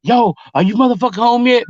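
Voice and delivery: high-pitched imitation of a partner yelling; retold yelling. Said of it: "official's yelling at me".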